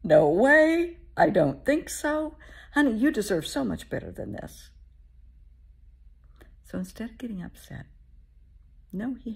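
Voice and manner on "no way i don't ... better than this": higher and louder, loving but firm no; God rejecting a bad match, higher, louder, firm then tender; God's refusal. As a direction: say no like a protective parent. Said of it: "He looks down on us and says".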